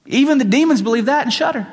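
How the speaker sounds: high, fast Southern US voice; Southern accent. Acted. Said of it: "he has a southern accent".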